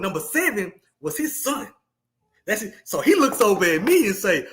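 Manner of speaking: usual speaking voice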